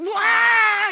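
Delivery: loud high bawling cry; mock child's wail. Son loses it at pageant, imitated loud bawling. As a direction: imitate a toddler's screaming wail. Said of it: "the little man lost it".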